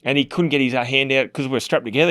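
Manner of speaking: ordinary delivery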